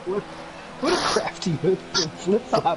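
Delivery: loud, high thrilled call; excited shout. Real, not acted. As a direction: yell it as the move sticks. Overtake called live, shouting.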